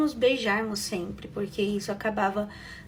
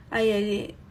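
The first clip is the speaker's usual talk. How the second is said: ordinary delivery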